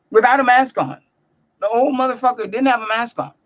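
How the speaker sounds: ordinary talk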